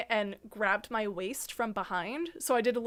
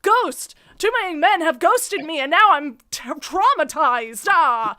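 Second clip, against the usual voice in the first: loud, high, hammed-up fright; mock terror; 4 semitones higher, 9 dB louder, slower than usual. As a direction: wail it in sarcastic fake trauma. Mocking would-be scarers with fake terror.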